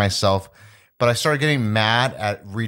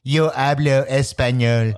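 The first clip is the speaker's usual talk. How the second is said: slow, mock Spanish line in a Trump voice; lightly voiced Trump speaking Spanish; a hint of Trump trying Spanish; slower than usual. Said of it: "It's in Spanish".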